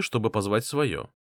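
plain reading voice

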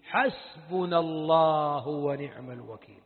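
حسبنا الله ونعم الوكيل drawn out low and slow; great solemn voice. Retold or imitated. Ibrahim in the fire answering calmly, slow and solemn. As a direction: intone it slowly, deep and grand like a prophet. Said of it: "قال بصوت عظيم جميل".

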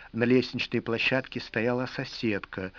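plain reading voice

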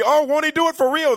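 high ecstatic church shout; joyful shout. Retold or imitated. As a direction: shout it like the whole church is on its feet. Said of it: "it's gonna be".

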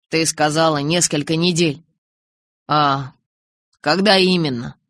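ordinary delivery

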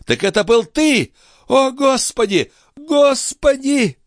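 high-pitched, horrified outcry; frantic, furious exclamations; crazed with rage. A narrator's reading. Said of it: "обезумев от ярости".

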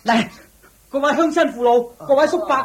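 high, loud street appeal to the elders; calling out to crowd. Acted. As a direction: project it across the street to gather people.

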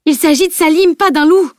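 high, loud indignant outburst; exploding. A narrator's reading. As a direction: protest hotly, pitch shooting up. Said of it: "explosa Camille".

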